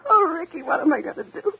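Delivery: very high, loud, breaking into sobs; weeping breakdown; sobbing despair. Acted. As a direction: cry out hopelessly. Breaks down crying over her scar.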